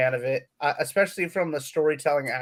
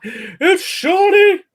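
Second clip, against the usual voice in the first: excited; 9 dB louder, much slower than usual. Real, not acted. Gasps on seeing a guest arrive.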